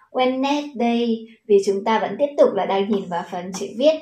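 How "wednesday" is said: "Wednesday" read as three syllables, wen-net-day; common mispronunciation. Shown as a demonstration. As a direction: sound out the silent part of "Wednesday" as "net". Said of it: "các bạn hay đọc là gì". (đọc sai "Wednesday" thành "oen-nét-đây")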